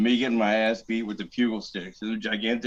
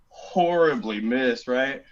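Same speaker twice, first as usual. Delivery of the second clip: ordinary talk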